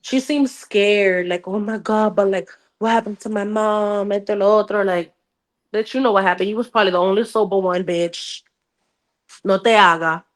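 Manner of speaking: ordinary talk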